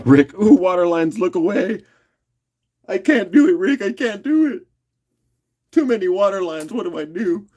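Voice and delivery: high, whiny wail of hammy despair; mock despair. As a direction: melodramatic helpless whine. Dramatic despair over water lines.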